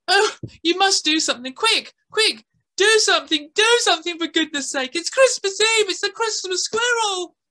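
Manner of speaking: put-on high, frantic girl pleading; girl begging the boy to save the squirrel. A narrator's reading. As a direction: beg him to hurry. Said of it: "And the girl said".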